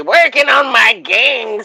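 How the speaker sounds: high, slow, loud whiny mimic; mocking Matthew. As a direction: drag it out like a whiny kid. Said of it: "says Matthew".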